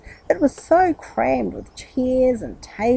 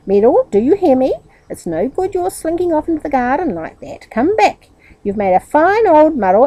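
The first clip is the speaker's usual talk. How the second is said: higher, louder firm order; firm scolding; 7 semitones higher, 6 dB louder than usual. A narrator's reading. Aunt ordering her nephew back, stern scolding. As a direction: call him back sternly. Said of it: "said Aunt Jemima firmly".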